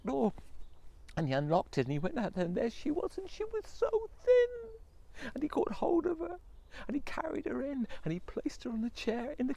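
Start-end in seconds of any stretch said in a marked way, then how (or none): 3.2-6.4 very slow, high, crying tone; tearful narration. A narrator's reading. Storyteller near tears over the thin wife. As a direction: let the pity break the voice.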